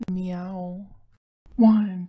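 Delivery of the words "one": shouted count. Acted. Counting in the meow song.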